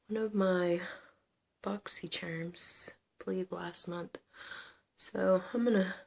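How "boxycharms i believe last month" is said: whispering. Real, not acted. Aside while applying eye gels.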